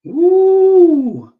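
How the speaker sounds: a very high, loud, drawn-out ooh; excited ooh. Real, not acted. Host reacting to the guest's moving story, thrilled. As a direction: let it burst out, delighted.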